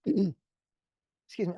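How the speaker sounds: slow words through a clogged throat; throat catching. Real, not acted. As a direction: clear the throat mid-phrase. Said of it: "I'm usually not this choked up".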